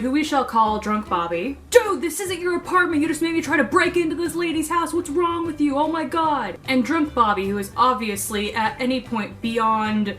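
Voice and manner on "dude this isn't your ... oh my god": high, scolding dude voice; exasperated frat boy. Retold or imitated. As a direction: scold your drunk friend. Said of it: "I hear him start to yell".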